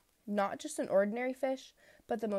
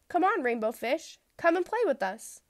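high, bright call to play; calling out playfully; 9 semitones higher, 4 dB louder than usual. Acted. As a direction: call him over to play. Fish friends calling him over.

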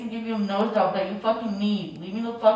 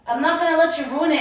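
ordinary talk, nothing marked

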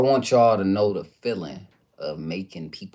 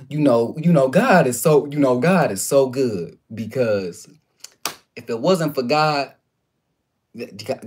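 lightly hammed-up preachy uncle, louder; uncle preaching; 3 semitones higher, 6 dB louder than usual. Nephew imitating uncle's preaching, a touch louder. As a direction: mimic the uncle praising God with hands. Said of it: "this him".

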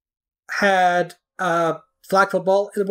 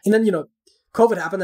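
usual speaking voice

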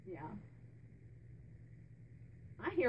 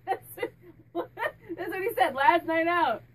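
higher and louder through laughter; laughing while talking; 5 semitones higher, 7 dB louder than usual. Real, not acted. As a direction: push the words out between laughs. Laughing at spirit's joke.

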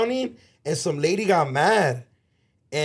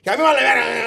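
put-on higher, louder retort in a Salvadoran accent; angry Salvadoran accent; 8 semitones higher, 6 dB louder, faster than usual. Lady shouting at a friend in a Salvadoran accent. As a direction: snap it back like the Salvadoran lady. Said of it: "You have a great Salvi accent".

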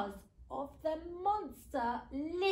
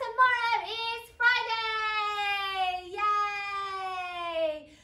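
loud, high, long-held words; excited cheer; 8 semitones higher, 7 dB louder, much slower than usual. Real, not acted. Presenter cheers to young audience.

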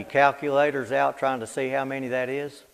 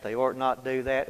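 usual speaking voice

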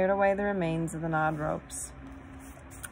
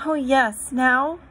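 put-on high, loud, eager child; excited; 7 semitones higher, 8 dB louder, slower than usual. A narrator's reading. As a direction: burst out with delighted anticipation. Lucy excited, jumping and clapping.

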